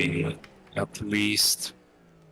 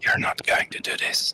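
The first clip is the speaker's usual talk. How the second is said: whisper. Pretend. Hushed taunt at teammate.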